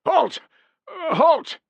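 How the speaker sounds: high, loud, nervous halt; unsteady challenge. A narrator's reading. Young sentry nervously challenging the runners in the dark. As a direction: shout halt with faltering nerve. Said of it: "a not altogether steady voice".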